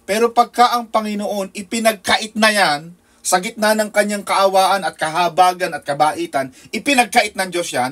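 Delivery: loud, driving sermon delivery; preaching at full force. Acted. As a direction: hammer it home from the pulpit. (malakas na pangangaral)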